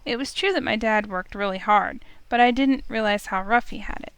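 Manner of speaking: ordinary delivery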